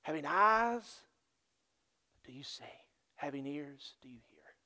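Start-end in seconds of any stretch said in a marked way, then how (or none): 2.2-4.6 soft whispered questions. Real, not acted. Preacher asking the church to listen, whispered.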